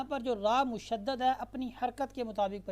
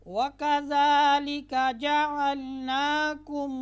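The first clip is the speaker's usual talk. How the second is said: slow melodic chanted recitation, higher and louder; full recitation; 4 semitones higher, 7 dB louder, much slower than usual. Shown as a demonstration. Teacher recites the complete verse again for students. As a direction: recite the whole verse slowly in tones. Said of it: "अब हम दोबारा मुकम्मल पढ़ते हैं".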